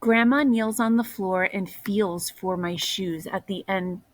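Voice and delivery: plain reading voice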